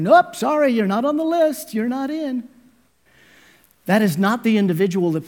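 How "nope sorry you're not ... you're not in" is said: higher, brisk mock refusal; dismissive gatekeeper. God imagined turning someone away, light mock dismissal. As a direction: brush him off breezily. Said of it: "And God is saying".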